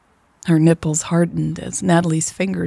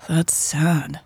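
sighed; 3 dB quieter than usual. A narrator's reading. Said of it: "Raven sighed".

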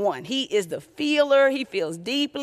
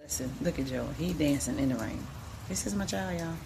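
soft, hushed narration over a video; hushed mom narrating the home video; quiet commentary; 7 semitones lower, 9 dB quieter than usual. Real, not acted.

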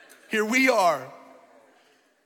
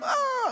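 put-on high drawn-out childish wail; mock crying wail. Father mimicking daughters told to clean their room, mock crying wail. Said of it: "They just start crying all the time".

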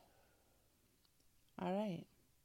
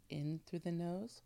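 guided breathing. Acted. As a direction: calmly guide each breath. Leads breathing exercise.

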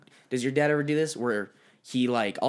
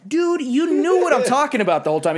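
mock exasperated yell; 10 semitones higher, 7 dB louder than usual. Retelling fight with dad.

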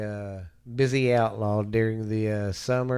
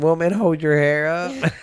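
loud shout; 5 semitones higher, 10 dB louder than usual. Retold or imitated. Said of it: "like Ricky Bobby's friend".

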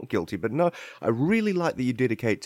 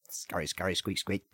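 soft scurry squeak; quiet squeaky rat noises; 6 semitones lower, 10 dB quieter, faster than usual. Acted. Rats scurrying.

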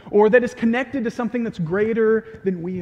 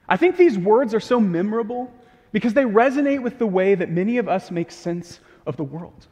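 ordinary delivery

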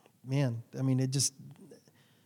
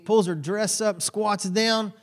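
ordinary talk

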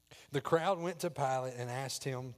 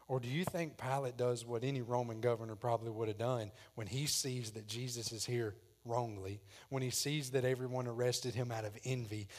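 usual speaking voice

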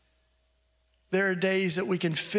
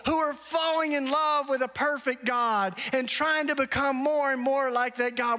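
higher, fervent preaching voice; 8 semitones higher than usual. Acted. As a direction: lift it up like the sermon's climax. Preaching at full force.

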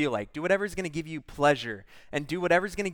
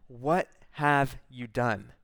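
grieved; 3 semitones lower, much slower than usual. Retold or imitated. God asking the woman, slow and grieved. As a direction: ask it with a heavy heart, slowly. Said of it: "a grieved kind of answering".

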